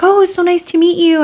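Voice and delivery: high-pitched imitation of a friendly hello; gushing greeting. Trainer greeting a new fan, gushing. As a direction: act out a gushy, polite greeting.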